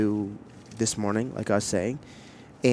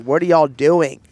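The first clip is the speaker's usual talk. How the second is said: higher, louder imitated onlooker disbelief; mock bewilderment; 5 semitones higher, 7 dB louder than usual. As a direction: call it out like a baffled bystander. Imitates puzzled onlookers staring.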